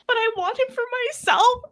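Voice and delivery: high, loud mock confession; confessing girl voice. Heroine admitting her feelings in a squeaky voice. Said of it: "she's like".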